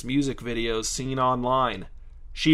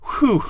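high, louder "whew" of relief; relieved whew; 9 semitones higher, 6 dB louder than usual. A narrator's reading. Donovan is spent after Sheena promises, relieved whew.

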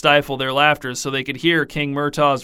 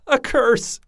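gasping; 10 semitones higher than usual. A narrator's reading. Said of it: "gasped Queen Nevara".

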